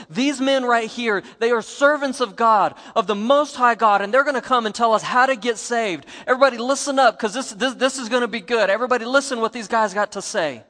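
loud, high proclaiming shout to the crowd; screaming out. Retold or imitated. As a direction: shout it out to everybody in the street. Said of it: "she starts screaming out saying".

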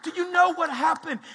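loud, high, insistent outcry; urgent complaint to God. Acted. Believer pleading their problems to God, urgent. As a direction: pour out your troubles at full volume.

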